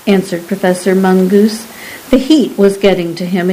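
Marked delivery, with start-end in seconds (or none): none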